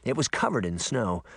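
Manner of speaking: plain reading voice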